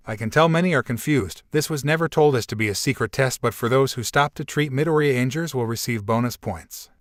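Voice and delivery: ordinary delivery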